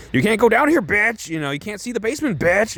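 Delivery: Jesse yelling up high, "bitch" tossed on the end; Jesse shouting. Retold or imitated. Jesse blocking the basement from house guests, high mock yell. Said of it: "and Jesse's just like".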